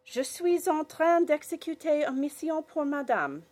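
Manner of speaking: put-on high girlish voice in French; bright little girl, speaking French; young girl voice. Girl character in story.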